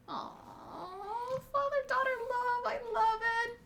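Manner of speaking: high, soft, gushing aww; touched cooing. Real, not acted. Reader gushing over father-daughter love, touched.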